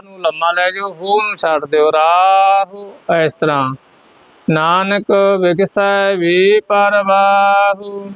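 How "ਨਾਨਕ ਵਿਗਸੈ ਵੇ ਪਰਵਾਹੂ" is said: slow, a clear pause before ਵੇ ਪਰਵਾਹੂ; ਵੇ ਤੋਂ ਵਿਸ਼ਰਾਮ ਲੈ ਕੇ; break before ਵੇ. Shown as a demonstration. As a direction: split ਵੇ from ਵਿਗਸੈ, slowly. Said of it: "ਵੇ ਤੋਂ ਤੋੜ ਕੇ ਵੇ ਪਰਵਾਹੂ".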